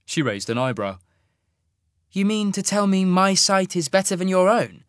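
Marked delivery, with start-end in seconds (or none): 2.1-4.8 higher, louder woman's voice, doubting; sceptical young woman. Acted. Sasha to Isaac, a higher doubting question. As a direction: raise an eyebrow in the voice.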